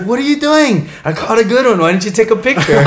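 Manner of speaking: high, loud mock complaint; hammed-up scolding shout, amused; imitated indignant friend. Friend zipping by scolds the rescuer, mock indignant yell. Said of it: "She's like".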